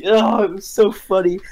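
high-pitched, helpless laughter in the words; cracking up at your own story; laughing. Real, not acted. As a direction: talk through a real giggle fit.

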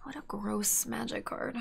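breathy whispered disgust; hushed grumble at a card. Real, not acted. Frustrated mutter at opponent's card.

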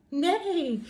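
high playful neigh; whinny like a horse; a child's play horse neigh; mock horse neigh. Animal sound in farm rhyme.

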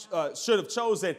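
ordinary delivery

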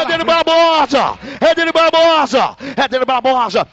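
shout. Real, not acted. Announcer calling rider's name.

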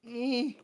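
effortful grunt opening a box; straining grunt. Pretend. Struggling to open box.

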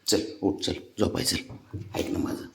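low, hushed coaxing murmur; soft coaxing. Real, not acted. Father softly coaxing his boy to bed, hushed. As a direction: coax gently under your breath.

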